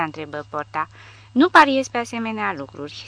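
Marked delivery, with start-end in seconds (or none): 1.3-3.0 a sharp, louder snap back; snapping. A narrator's reading. Old soldier snapping at Porta, irritated. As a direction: bite off the words irritably. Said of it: "se stropși bătrânul".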